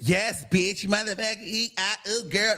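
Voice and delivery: mock over-familiar camp greeting. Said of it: "you just".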